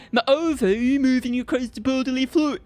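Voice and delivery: loud, hammed-up mock Canadian; badly faked Canadian with stretched vowels; attempted Canadian accent. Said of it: "I can't do it".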